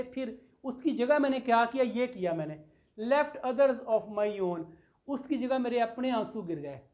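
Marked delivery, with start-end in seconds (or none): 3.0-4.6 very slow, drawn-out reading of the verse; reading poetry aloud to a class; slow poem recital. Shown as a demonstration. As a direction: let each word of the line sink in. Teacher reciting a poem line.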